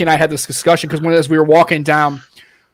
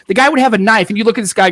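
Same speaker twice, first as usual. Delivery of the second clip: normal talking voice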